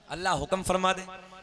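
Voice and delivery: normal talking voice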